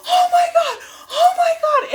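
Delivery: high shriek through laughter and tears; overjoyed scream; laughing-crying excitement. Real, not acted. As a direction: squeal it, half laughing, half crying. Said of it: "freaking out".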